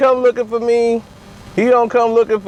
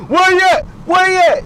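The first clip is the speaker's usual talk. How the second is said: shouting search; 7 semitones higher than usual. Acted. Raiders shouting for him.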